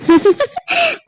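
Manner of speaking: high, scheming cackle of delight; gleeful laugh. Acted. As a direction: laugh at how well the plan worked. Mother gloats over her plan.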